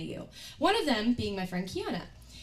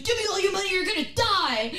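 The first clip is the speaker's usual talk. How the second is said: higher, pushed robbery demand; mock robber threat; 7 semitones higher, 3 dB louder than usual. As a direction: bark it like a hammed-up stickup.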